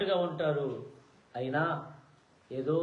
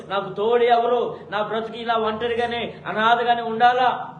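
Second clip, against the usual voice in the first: lament; 6 semitones higher, 10 dB louder than usual. Acted. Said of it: "ఏడుస్తూ".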